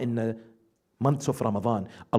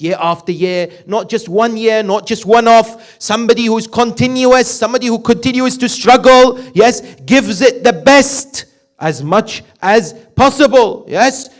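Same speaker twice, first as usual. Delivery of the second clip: loud, higher preaching voice, driving each point home; fervent preaching; 8 semitones higher, 16 dB louder than usual. Acted. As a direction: preach it out to the congregation. Preaching at full force.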